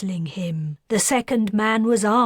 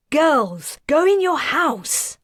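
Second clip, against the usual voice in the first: firm mother; 8 semitones higher, 5 dB louder than usual. A narrator's reading. Mother orders children inside.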